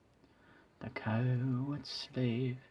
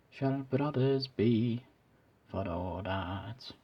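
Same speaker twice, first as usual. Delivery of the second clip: Scottish accent. Shown as a demonstration. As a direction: swell into the line in Scottish accent. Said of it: "not the best Scottish accent I've ever done".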